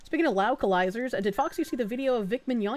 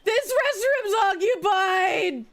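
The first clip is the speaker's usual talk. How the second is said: anguished, slow sobbing yells; crying shout; 8 semitones higher, 3 dB louder, much slower than usual. Acted. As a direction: wail it out in grief. Voices distraught game character.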